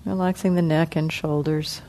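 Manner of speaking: soft, unhurried meditation guidance with long pauses; guided meditation. Acted. Teacher guiding the sitting group through body relaxation, soft and calm. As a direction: ease the group gently through each part of the body.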